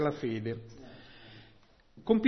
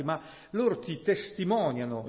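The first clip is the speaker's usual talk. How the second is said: ordinary delivery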